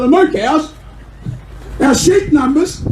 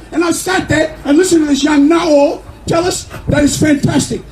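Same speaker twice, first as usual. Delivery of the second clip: usual speaking voice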